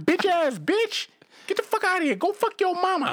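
high-pitched, drawn-out Harlem street voice; 1975 Harlem accent. Acted. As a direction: do a hammed-up seventies Harlem accent. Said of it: "I would do that accent for you".

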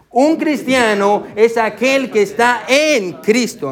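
slow, spaced recitation of "Un cristiano es aquel que está en Cristo"; slow group recitation. Shown as a demonstration. Pastor leading congregation to repeat together, slowly. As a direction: lead the room saying it together slowly. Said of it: "Vamos a repetirlo todo".

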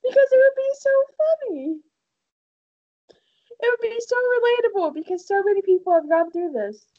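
high, eager sales pitch; excited. Real, not acted. Excited pitch for dating podcast.

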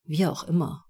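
plain reading voice

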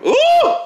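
sharp high yelp of pain; a sudden real cry of pain. Real, not acted. Foot hurt, then "that hurt my foot.

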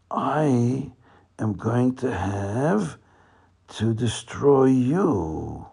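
very slow, menacing villain; deliberate, ominous warning; slow threat. Acted. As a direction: draw out the threat word by word. Leader threatens to destroy the Shochet.

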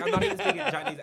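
high, loud, fed-up cry of protest; retelling a film character's frustrated shout; exasperated outburst. Retold or imitated. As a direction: blurt it out, exasperated at not being understood. Frustrated man retold in story.

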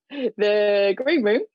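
slow, careful try at a London accent; hesitant London accent attempt; tentative London accent. Shown as a demonstration. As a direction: have a go at a London accent, unsure. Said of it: "Stephen's asking the interpreter to do a London accent".

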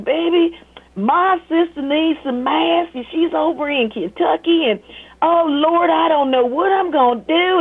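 high, sweet churchy elder lady; caller's voice. Retold or imitated. Older caller pleading about masks, high and sweet. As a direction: voice the caring older woman calling. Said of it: "she was like".

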